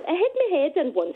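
normal talking voice

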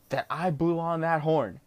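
ordinary talk, nothing marked

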